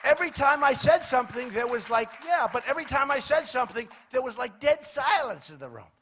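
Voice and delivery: loud, excited bragging about the room going silent; loud boast. Real, not acted. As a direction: trumpet the debate win to the crowd. Boasting loudly about debate.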